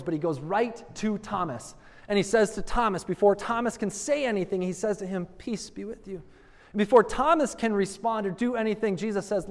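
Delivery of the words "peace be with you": gentle greeting. Acted. Jesus greeting Thomas.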